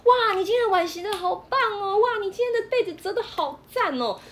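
put-on high, gushing praise as if to a small child; sweet over-the-top encouragement for a kid; praising like a child. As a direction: coo over him like a proud parent. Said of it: "像个孩子一样鼓励他".

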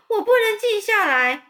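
high, loud hysterical squeal; panicked scream. A narrator's reading. Wilbur shrieks back at Charlotte, high and loud. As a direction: refuse to calm down, frantic. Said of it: "韦伯就尖叫说".

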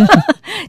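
high burst of laughter. Real, not acted. Laughing at her own bad answer to mother. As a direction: laugh at yourself.

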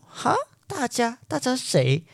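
high, startled "huh? everyone? who?"; surprised disbelief. Retold or imitated. Hearing that the whole school stares, startled. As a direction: caught off guard, asking back. Friend says everyone watches.

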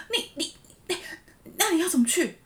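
a flustered manager sputtering in shock; panicked boss. Retold or imitated. Boss panicking as she leaves for hospital, high flustered stammer. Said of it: "然后我主管超紧张".

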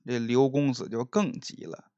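plain reading voice

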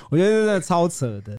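high laugh spilling into the words; can't stop laughing at it. Real, not acted. Laughing at absurd story. (笑着说太扯了)

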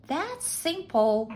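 high, slow, proud answer; high maid voice, proud. A narrator's reading. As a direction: draw it out high with pride. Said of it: "said Nellie Mae proudly".

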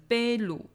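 贝鲁 with 贝 stressed and high, 鲁 unaccented; stress on first syllable. Shown as a demonstration. Teacher showing the rule without accent mark, first-syllable stress on 贝鲁. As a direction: put the weight on 贝 in 贝鲁. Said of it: "也就是悲上".

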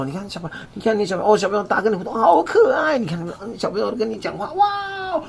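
put-on high, gushing coo over a child; gushing baby talk. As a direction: coo like talking to a toddler. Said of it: "那些单身就说：".

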